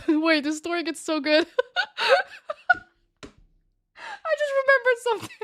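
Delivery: high, squeaky talk through real laughter; laughing hard. Real, not acted. Storyteller cracking up at a memory, laughing. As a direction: crack up while getting the words out.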